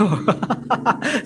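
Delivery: a short real laugh at a viewer's question; amused laugh. Real, not acted. Teacher laughing at a student's certificate question, amused laugh. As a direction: laugh it off lightly.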